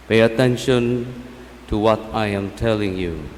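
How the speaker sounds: calm scripture reading; kindly vicar sermon. Acted. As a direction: read the Gospel gently and clearly. Priest reads the Gospel aloud.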